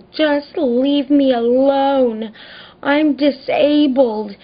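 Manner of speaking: high, drawn-out mock wail; mock crazed wail. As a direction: imitate a neighbour going crazy, high and stretched out. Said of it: "she goes crazy".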